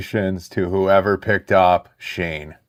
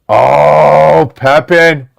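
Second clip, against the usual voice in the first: excited outburst; 5 semitones higher, 8 dB louder, much slower than usual. Real, not acted. Reacts to a big card pull.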